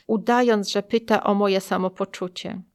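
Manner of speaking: ordinary delivery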